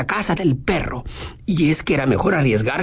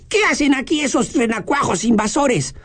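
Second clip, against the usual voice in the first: angry; 7 semitones higher than usual. Acted. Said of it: "dijo muy enojado con las cejas apretadas".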